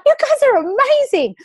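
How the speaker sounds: high, bright squeal of praise; gushing admiration. Retold or imitated. Admiring colleagues who finish jobs early, gushing high. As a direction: gush over them like a starstruck fan.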